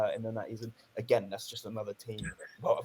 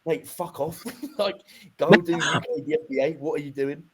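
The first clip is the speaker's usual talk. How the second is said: mock outrage; 5 semitones higher, 7 dB louder than usual. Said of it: "making jokes 'cause I was that upset".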